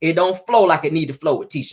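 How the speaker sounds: ordinary talk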